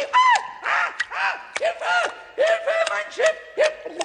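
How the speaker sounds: high, panicked call for help; crying for help. Acted. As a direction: shriek as the ship goes down. Boat sinks.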